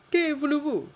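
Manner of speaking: French director. Acted. Director confronts the stranger, high loud French.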